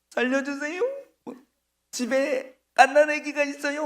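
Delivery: pitiful begging. Retold or imitated. Condemned man begging his killer, high pitiful plea. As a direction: beg for your life pitifully. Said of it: "불쌍하게 보여가지고".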